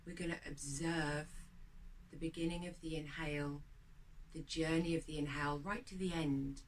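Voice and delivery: quiet guided breathing meditation voice. Acted. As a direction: lead the breath calmly and gently.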